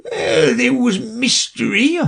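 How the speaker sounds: high, slow, teary; a weepy old creature; sobbing turtle voice. Acted. Mock Turtle crying.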